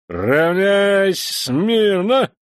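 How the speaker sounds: very slow, loud, high bark; barked command. Acted. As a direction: bark the drill order. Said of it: "рявкнул старший лейтенант".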